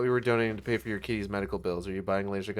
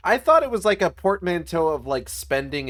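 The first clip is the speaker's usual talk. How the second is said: excited; 7 semitones higher, 8 dB louder than usual. Real, not acted. Excited teasing explanation.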